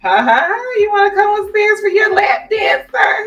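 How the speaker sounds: high-pitched, playfully mocking seductress; mock come-on. Wife awkwardly offering her husband a lap dance, mock come-on. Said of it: "making, like, sexy coupons for him".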